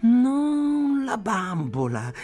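high, loud, drawn-out wail; child shouting through tears; tearful shout. A narrator's reading. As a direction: cry out in tearful protest, stretching the words. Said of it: "gridò Ayla in lacrime".

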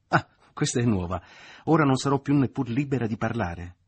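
offended outburst. Acted. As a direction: flare up, deeply offended. Mother offended by her daughter.

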